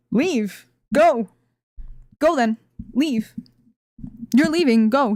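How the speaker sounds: short acted commands with gaps between; retold angry orders. Worker staring down the vendor, acted curt dismissal. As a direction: act out ordering someone out, word by word.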